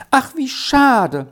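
bursting out high and drawn out; child cries out in dismay; exclaiming. A narrator's reading. Sally bursts out to Erik in dismay. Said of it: "Jetzt rief sie ausbrechend".

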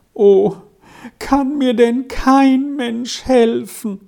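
high, slow, drawn-out plaintive sigh; sighing despair. A narrator's reading. Lonely boy sighs that nobody can help him, despairing. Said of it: "seufzte Lauri manchmal auf".

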